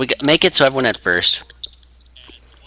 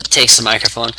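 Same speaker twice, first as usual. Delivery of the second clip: ordinary talk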